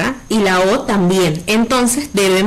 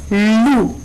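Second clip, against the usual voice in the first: "lu" isolated and held; stressed syllable alone. Shown as a demonstration. Teacher showing the strongest syllable of luna, "lu" stressed and held. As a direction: give "lu" alone full weight. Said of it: "la que suena más fuerte".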